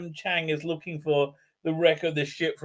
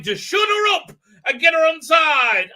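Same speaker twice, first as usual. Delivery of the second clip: high, loud, hammed-up exclamation; mock enthusiastic shout; 10 semitones higher, 8 dB louder than usual. Doctor explaining the treasure plan, mock enthusiastic shout. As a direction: shout it mockingly, as if a brilliant plan. Mocking the Doctor's stated reason.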